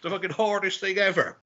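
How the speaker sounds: high, awed exclamation, imitating a friend; awed flatmate. Flatmate raving about an EastEnders fight, high and awed. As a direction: gush in disbelief about the fight scene. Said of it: "And my flatmate was like".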